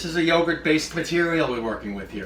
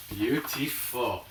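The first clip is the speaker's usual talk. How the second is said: ordinary talk, nothing marked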